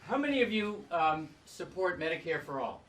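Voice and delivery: loud, projected to the back; to someone hard of hearing. Real, not acted. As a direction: call the question out to the crowd. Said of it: "Can you speak up".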